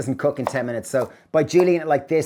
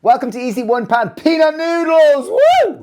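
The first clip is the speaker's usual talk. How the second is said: excited shout; 12 semitones higher, 8 dB louder than usual. Real, not acted. Opening greeting called to viewers.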